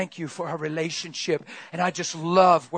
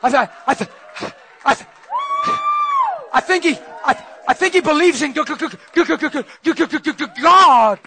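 stuttering, rising to a loud high burst on God; panicked stammering; 7 semitones higher, 8 dB louder than usual. Acted. The imp panics as it reports the believer to the devil, stammering loud and high. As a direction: choke on the word, then blurt it out.

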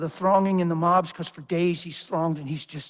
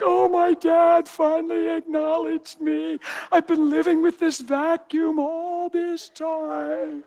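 high, slow mock-dreamy sigh of relief; hammed-up sappy gratitude; mock sentimental; 9 semitones higher, slower than usual. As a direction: parody a needy son, slowly. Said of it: "I wasn't there like".

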